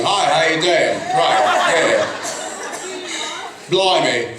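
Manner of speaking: mock Australian accent. Shown as a demonstration. Panelist showing a fan what Australia sounds like, mock Australian accent. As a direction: put on a jokey Australian accent. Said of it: "Australia's like this".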